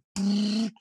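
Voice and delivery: high, loud rotor whir noise; vocal imitation of blades kicking up; imitated rotor starting up. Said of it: "we hear the rotor blade just go".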